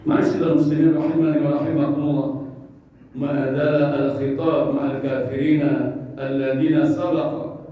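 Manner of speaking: normal talking voice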